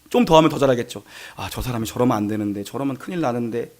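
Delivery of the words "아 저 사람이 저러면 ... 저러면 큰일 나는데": low, soft worried thought; inner murmur. Acted. Pastor privately worrying about a church member, hushed. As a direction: voice it privately, under your breath. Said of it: "내가 말은 안 하지".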